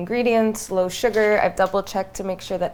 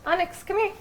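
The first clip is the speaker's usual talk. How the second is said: calling the dog; 11 semitones higher than usual. Real, not acted.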